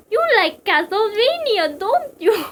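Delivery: put-on high, loud, failed Russian accent; hammed-up Russian accent, pitched high and drawn out; Psycho Mantis. Mantis teasing Snake, badly faked high Russian accent. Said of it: "I can't do a Russian accent".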